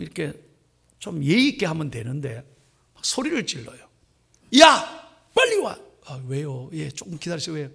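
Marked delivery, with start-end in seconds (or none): 4.6-5.8 loud, high barking call to the waiter; rude yell. Retold or imitated. Pastor imitating a rude tourist yelling at a waiter, loud yell. 6.1-7.7 meek reply. Retold or imitated. Pastor voicing the busy waiter answering the customer, meekly.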